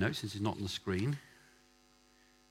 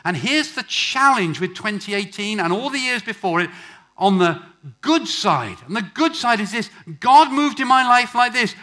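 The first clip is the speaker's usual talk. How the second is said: loud, high, impassioned sermon; full-force preaching; 11 semitones higher, 14 dB louder than usual. Real, not acted. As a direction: preach it out to the whole congregation.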